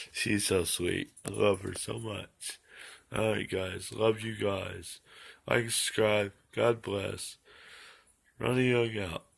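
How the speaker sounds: hushed and low, barely voiced; soft sign-off. Real, not acted. Vlogger signing off to fans in a hushed voice. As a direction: wind down softly so the dogs can sleep.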